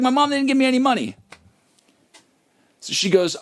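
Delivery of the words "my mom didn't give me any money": higher and fast, mocking his own excuse; whiny mock kid. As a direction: mimic yourself as a whiny teen, rushed. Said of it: "I was like".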